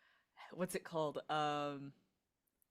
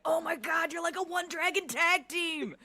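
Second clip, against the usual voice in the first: high, loud hyped-up gushing; super excited; 13 semitones higher, 8 dB louder than usual. Acted. Alivire runs up to Tessel, super excited. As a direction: gush at full volume, pitched way up. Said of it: "Super excited over that fight".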